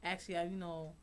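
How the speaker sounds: normal talking voice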